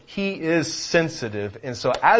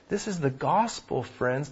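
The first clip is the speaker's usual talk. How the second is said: usual speaking voice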